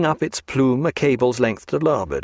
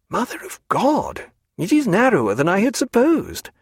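high-pitched burst of astonishment; startled exclamation; 10 semitones higher than usual. A narrator's reading. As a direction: exclaim it high, taken aback. Character exclaims, startled by the strait.

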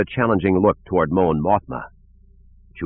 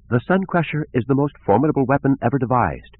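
lightly voiced gentle scientist; musical female voice; 7 semitones higher than usual. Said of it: "Her musical voice".

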